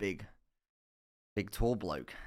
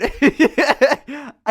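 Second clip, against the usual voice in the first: big shrieking laugh; loud high laugh; 14 dB louder than usual. Real, not acted. Laughing at the lecturer's inside scoop, loud.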